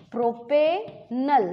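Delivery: Propanal drawn out slowly; slow dictation. Shown as a demonstration. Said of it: "तो कैसे लिखते हैं इसको अपन?". (प्रोपेनल धीरे-धीरे लिखवाना)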